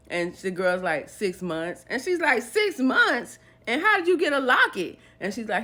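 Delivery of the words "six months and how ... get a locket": high-pitched, affronted questioning; acting out an indignant girlfriend; retold incredulous echo. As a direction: throw the answer back in disbelief. Maria echoes the answer in disbelief.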